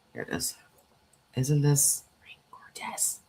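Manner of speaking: hushed admiring whisper. Real, not acted. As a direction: breathe it out in awe. Admiring ring, hushed aside.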